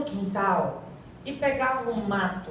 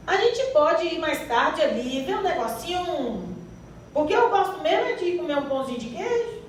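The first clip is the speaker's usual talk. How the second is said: put-on playful, higher-pitched Minas Gerais accent; Mineiro accent; 4 semitones higher than usual. Preacher imitating someone from Minas for the congregation to guess, Mineiro accent. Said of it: "É mineirinho".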